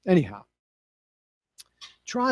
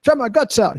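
higher cowboy imitation; higher imitation of the bull rider; Ty Murray's voice; 7 semitones higher than usual. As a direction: repeat Ty's phrase in his voice. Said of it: "He talks this way".

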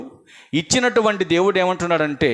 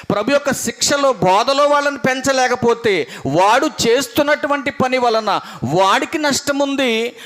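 high, slow preaching warning; preacher's sustained, raised warning; emphatic preaching; 5 semitones higher, slower than usual. Real, not acted. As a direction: drive each condition home, raised and drawn out.

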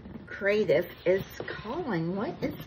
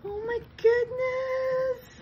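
high, slow, overwhelmed gasp then goodness; gasp of delight; slower than usual. Real, not acted. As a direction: catch your breath and let it soar. Opening the gift box.

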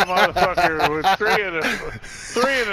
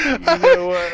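higher, louder indignant turn; mock huff; 10 semitones higher, 4 dB louder than usual. Real, not acted. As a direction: pretend to storm off.

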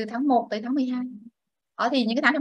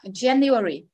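January run together a little quicker; January at a slightly brisker teaching pace; slightly faster; much slower than usual. Shown as a demonstration. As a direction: say January as one connected word. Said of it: "Đọc nhanh hơn xíu ha".

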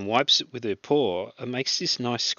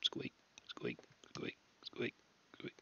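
breathy whispered squeak noises, much softer; 13 semitones lower, 16 dB quieter than usual. Retold or imitated. Describing the dog at the window, whispered squeaks. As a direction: hush the squeaks right down. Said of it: "licking the window and wiping it".

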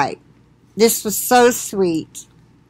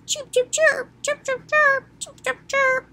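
high chirping bird imitation; 5 dB quieter than usual.

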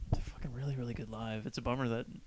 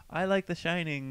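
slightly higher, half-laughing imitation of a fan; heckling fan's voice; 5 semitones higher than usual. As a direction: call out as a gig heckler, lightly mimicked. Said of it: "you hear this voice going".

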